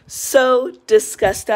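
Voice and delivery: "So disgusted" drawn out slowly, word by word; slow, weighty stress on "So disgusted"; emphatic repeat. Real, not acted. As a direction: lean hard and long on "So disgusted".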